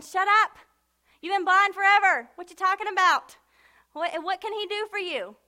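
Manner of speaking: loud, high mock scolding; scolding crowd. Crowd telling the blind man to shut up, scolding loudly. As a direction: put him down loudly as the heckling crowd. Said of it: "everybody was saying".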